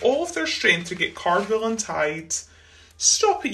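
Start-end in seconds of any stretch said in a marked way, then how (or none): 3.0-3.5 squealed objection, pitched well up; mild storybook yell. A narrator's reading. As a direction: yelp it as a giraffe being tugged. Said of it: "yelled Cardwell".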